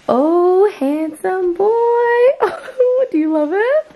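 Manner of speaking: high slow cooing praise; doting. Real, not acted. Cooing at dressed-up son.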